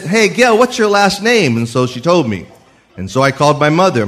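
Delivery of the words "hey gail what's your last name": higher-pitched mock casual phone query; retold calling out. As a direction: hail her on the phone, pitch raised. Retold phone call to fiancée.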